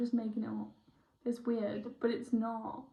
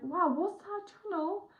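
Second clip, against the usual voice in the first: much higher, slow and drawn-out wow; amazed wonder; 8 semitones higher than usual. Retold or imitated. Recalling her first channeling, slow and amazed. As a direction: marvel at something strange you just saw. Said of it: "I was like".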